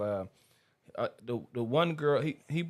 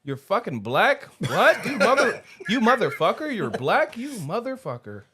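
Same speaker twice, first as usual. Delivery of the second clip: imitating an outraged woman, higher and louder; mad; 7 semitones higher, 5 dB louder than usual. Retelling the show's betrayed girlfriend to friends, mock mad. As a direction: act out her incredulous outrage, pitch climbing, half laughing. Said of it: "She was so mad".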